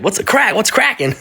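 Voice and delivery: light imitation of "what's crack-a-lacking"; mock street greeting. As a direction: say "crack" voiced and casual, rising. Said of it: "Which means, like".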